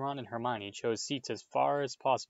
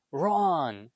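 loud, higher, drawn-out call; loud shout; 5 semitones higher, 7 dB louder than usual. A narrator's reading. Percy catching his brother, shouting loudly. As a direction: shout the name in alarm. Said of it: "when a loud voice".

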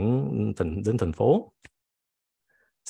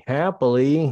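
happy lift; 5 semitones higher, 5 dB louder than usual. Shown as a demonstration. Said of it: "Còn ngược lại".

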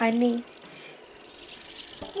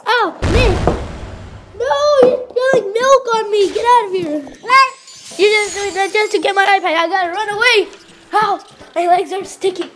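shrieking; 9 semitones higher, 7 dB louder than usual. Real, not acted. Sibling spilled milk on her.

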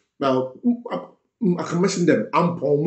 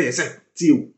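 ordinary talk, nothing marked

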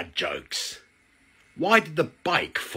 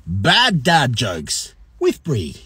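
usual speaking voice

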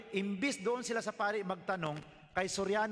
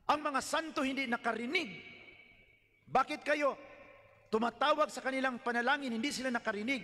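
rising challenge; 7 semitones higher than usual. Pretend. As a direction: press him hard with each question. Challenging the pastor on saints.

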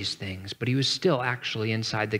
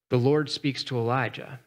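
ordinary talk

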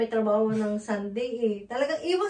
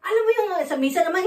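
ordinary delivery

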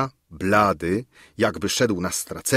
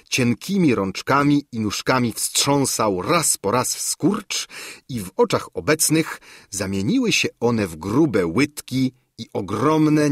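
usual speaking voice

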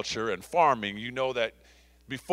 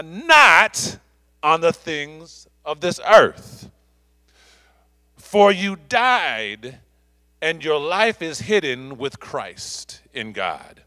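slow, raised, emphatic pulpit reading; scripture reading; 3 semitones higher, slower than usual. Real, not acted. Pastor reading for you died to the congregation, slow and ringing. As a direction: proclaim each phrase with weight, dropping at the close.

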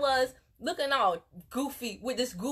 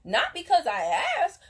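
ordinary delivery

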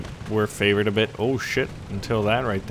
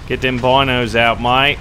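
hammed-up Aussie bloke; broad, mock Australian; bad Australian accent; 4 dB louder than usual. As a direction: put on your worst Australian accent. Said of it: "your worst Australian accent".

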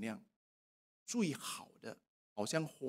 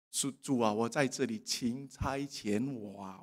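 humble prayer; 5 semitones lower, 8 dB quieter than usual. Acted. Quiet surrendering prayer to God.

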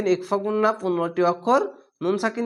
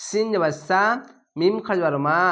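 ordinary delivery

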